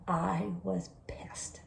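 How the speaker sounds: slow, very soft seething; hushed, deliberate and peeved; hushed anger. Retold or imitated. Tells how pissed she was.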